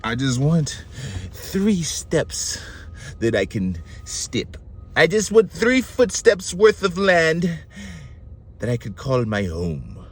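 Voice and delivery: put-on slow, halting holy man in a muddled accent; spaced-out phrases in a put-on, wandering accent; Vamana. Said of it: "I don't even know what accent I'd be doing".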